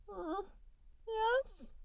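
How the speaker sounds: high, small, teary creature whimper; a scared little blob caught out. A narrator's reading. Goo whimpering as Mike stares him down. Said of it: "he whimpers".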